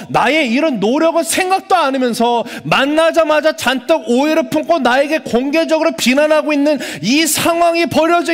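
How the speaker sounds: a pastor pleading Peter's side, building up; impassioned preaching. Acted. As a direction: preach his hurt with growing heat. Preacher voicing Peter's grievance.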